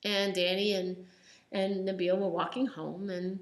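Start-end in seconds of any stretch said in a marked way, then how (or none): none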